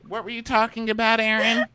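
normal talking voice

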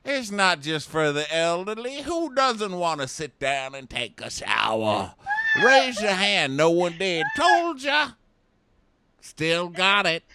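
high, loud mock old-timer; playful old man impression; hammed-up old man. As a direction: boast like a cocky old codger. Voicing old construction worker.

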